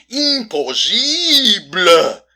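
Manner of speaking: loud, high, drawn-out French pronunciation; French accent. Acted. Detective hammering disbelief home, French accent. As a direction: stretch it out grandly in a French accent. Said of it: "Or as the French say".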